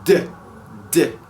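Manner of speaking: a voiced "D" said alone; a voiced "D" sound. Shown as a demonstration. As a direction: buzz the "D". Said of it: "como se fosse um".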